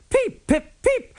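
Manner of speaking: very high imitated engine whistle; high peeping whistle; whistle peeps. A narrator's reading. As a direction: peep like a steam whistle. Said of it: "called Thomas".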